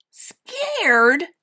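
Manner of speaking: high, loud, incredulous question; scornful little bear, rising; snorted scorn. A narrator's reading. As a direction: echo it in mocking disbelief. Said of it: "Little Bear snorted".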